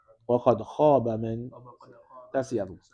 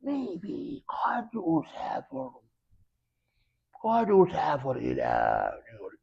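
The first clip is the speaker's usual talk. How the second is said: pondering doubt; 5 dB quieter than usual. Shown as a demonstration. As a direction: stretch it out as if mulling it over. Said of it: "you got to get the beard and".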